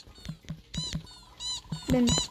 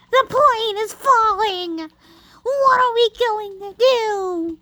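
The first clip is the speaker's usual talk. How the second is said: mock panic; 6 semitones higher, slower than usual. Panic in plane game.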